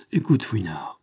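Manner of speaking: souffle bas et grave, presque chuchoté; ton solennel. A narrator's reading. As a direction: pose la main sur l'épaule, et murmure gravement. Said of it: "dit-il d'un ton solennel". (ami qui avertit Fouinard, chuchotement solennel)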